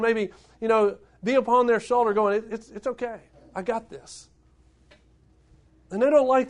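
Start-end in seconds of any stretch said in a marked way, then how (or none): 2.4-4.3 softer, gentle comforting voice; quiet comforting words; soft reassurance. Retold or imitated. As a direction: murmur it like a hand on the shoulder. Jesus comforting hand on shoulder.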